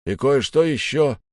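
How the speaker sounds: high, projected reply; calling from above. A narrator's reading. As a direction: report loudly across a distance. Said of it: "ответили сверху".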